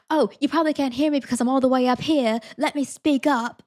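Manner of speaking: sarcastic British man. Retold or imitated. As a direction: play the huffy tourist raising his voice.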